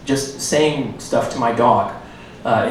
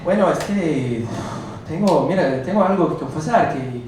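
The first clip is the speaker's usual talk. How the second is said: higher, hammed-up Spanish stand-up persona; alter ego Nicolas; 4 semitones higher than usual. Comic in his alter ego greets the crowd as Nicolas. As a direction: play the cocky gringo comic Nicolas. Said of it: "Back to Nicolas".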